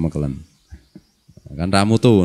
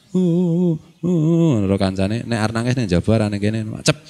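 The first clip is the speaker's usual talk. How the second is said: imitated film crying. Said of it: "kemungkinan mati ku nangis".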